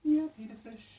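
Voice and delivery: put-on high sing-song baby voice for the fish; pet talk. Owner greeting her new fish in high, sing-song pet talk.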